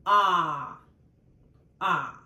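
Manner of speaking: ah said as a full, open stressed vowel; ah, open and held. Shown as a demonstration. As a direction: sound out ah clearly as a stressed vowel. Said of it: "when stressed".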